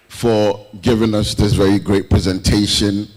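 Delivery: normal talking voice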